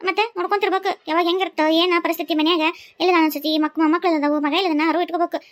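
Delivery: ordinary talk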